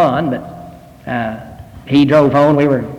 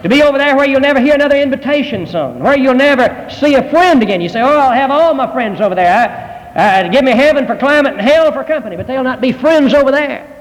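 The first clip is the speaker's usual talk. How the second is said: higher, urgent pulpit warning; preaching at full force; 8 semitones higher than usual. Acted.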